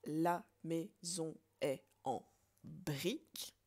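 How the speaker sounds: slow, word by word counting the syllables. Shown as a demonstration. Teacher counting syllables slowly for students. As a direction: spell out the line slowly for the class. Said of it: "Je reprends ma phrase".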